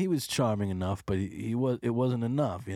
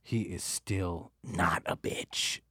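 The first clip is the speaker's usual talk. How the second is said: ordinary talk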